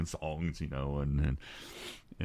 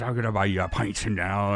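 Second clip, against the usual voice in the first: put-on Irish accent; 5 semitones higher, 5 dB louder than usual. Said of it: "I can't do his accent very well".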